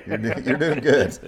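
chuckled praise; laughing. Real, not acted. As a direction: reassure him through a laugh. Laughing reassurance to the guest.

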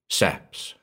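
ordinary delivery

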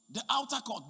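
usual speaking voice

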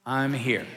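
I'm here said flat with a falling full stop; flat statement. Shown as a demonstration. Pastor has the church say "I'm here" flatly with a period. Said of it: "with a period after it".

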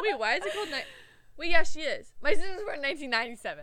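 high, very loud and giggly; laughing confusion. Real, not acted. Host muddles birth years with cohost, loudly cracking up. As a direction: shout it through real laughter.

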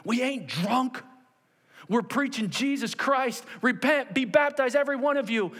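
high, forceful proclamation; impassioned preaching. Acted. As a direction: preach as Peter to a crowd. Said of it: "Peter said".

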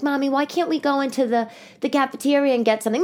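pleading daughter. Retold or imitated. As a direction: lightly imitate a child asking mom. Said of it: "my daughter's like".

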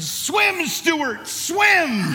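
high, loud cheering-on yell; re-enacted full-voice yell. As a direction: holler each word to urge him on. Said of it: "I yelled in a nice loud voice".

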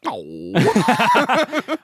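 high put-on wounded "oh"; hammed-up little moan of disappointment; mock hurt groan. Randall losing out to best scarer, mock hurt moan. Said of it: "Randall a little bit like".

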